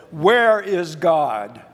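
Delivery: slow, loud, stern demand. Retold or imitated. Minister confronting two mischievous boys, stern. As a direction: ask it slowly and sternly, weighting every word. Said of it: "he looked at them very sternly".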